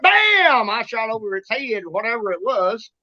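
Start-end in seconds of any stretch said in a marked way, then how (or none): 0.0-0.7 gunshot sound. Retold or imitated. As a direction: mimic a gunshot. Gunshot imitation.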